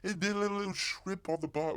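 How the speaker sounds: high-pitched, muddled fake British accent; mock accent. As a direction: keep the silly foreign accent going, high. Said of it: "they don't do shrimp".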